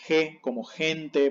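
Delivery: "ge, como gente" with g as jota, je; Spanish soft G. Shown as a demonstration. Teacher cites Spanish habit, "ge" pronounced je. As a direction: jota sound starting both "ge" and "gente". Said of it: "en español se lee ge".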